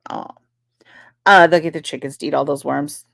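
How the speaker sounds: ordinary talk, nothing marked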